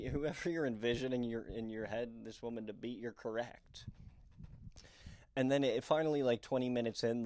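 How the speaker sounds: ordinary talk, nothing marked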